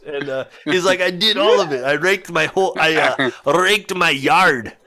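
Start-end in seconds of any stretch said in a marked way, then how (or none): 1.0-4.7 imitated old Dutchman, higher and halting; Dutch accent. Old man tells driver he raked it all, Dutch accent. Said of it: "that's definitely what the accent sounded like".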